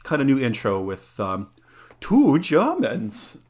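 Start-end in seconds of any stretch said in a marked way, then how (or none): 2.0-3.4 slow, high mock German accent; fake German accent. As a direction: drag it out in a hammy German accent. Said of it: "'cause we love our fake German accents".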